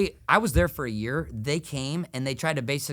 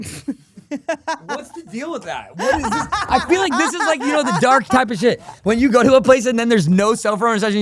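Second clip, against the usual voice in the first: laughing; 8 semitones higher, 10 dB louder than usual. Real, not acted. Laughing rant about no reception.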